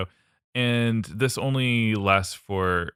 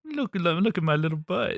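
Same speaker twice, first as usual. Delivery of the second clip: high cutesy baboon voice; 10 semitones higher than usual. Pretend. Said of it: "as a baboon".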